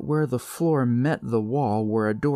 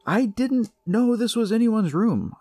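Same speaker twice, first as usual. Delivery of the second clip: raised, loud pleading voice; high indignant protest; 13 semitones higher, 5 dB louder than usual. A narrator's reading. Narrator answers the bully.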